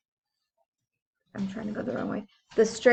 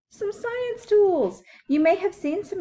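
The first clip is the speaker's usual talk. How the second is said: storybook read-aloud; 10 semitones higher, 3 dB louder than usual. Acted. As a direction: stretch each word like a picture book. Reading book cover to children.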